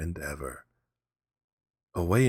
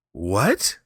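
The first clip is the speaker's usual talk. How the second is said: surprised; 3 semitones higher, 7 dB louder than usual. Acted. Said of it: "said Kiave".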